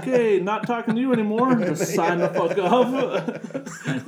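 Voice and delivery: hammed-up fed-up friend; mock outrage. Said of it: "And I'm like".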